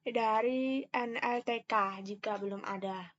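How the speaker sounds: ordinary talk, nothing marked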